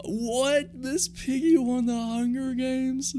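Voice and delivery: high, slow, drawn-out disbelief; can't believe it, stretch every word; incredulous. Real, not acted. Host asking viewers in shock who won, high and slow.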